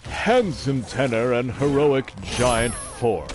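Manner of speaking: overly dramatic voice. Pretend. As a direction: declaim like a hammy superhero. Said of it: "my overly dramatic voice".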